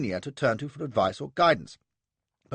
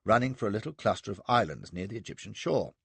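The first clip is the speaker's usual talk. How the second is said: ordinary delivery, nothing marked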